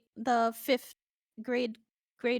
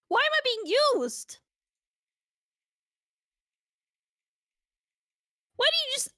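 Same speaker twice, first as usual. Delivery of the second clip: high, loud incredulous complaint; indignant protest; 12 semitones higher, 5 dB louder, faster than usual. Real, not acted. Streamer objecting to being used to talk to chat, indignant. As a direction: protest loudly, pitch leaping up.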